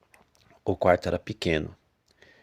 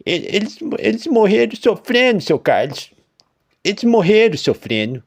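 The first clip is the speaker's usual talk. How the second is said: high, loud, anguished; anguished farmer confession; grieving farmer; 12 semitones higher, 6 dB louder than usual. A narrator's reading. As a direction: cry out in guilt. Farmer grief.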